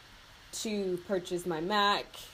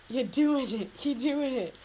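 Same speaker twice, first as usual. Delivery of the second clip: excited; 5 semitones higher, 4 dB louder than usual. Retold or imitated. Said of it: "I was just like".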